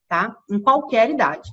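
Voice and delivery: normal talking voice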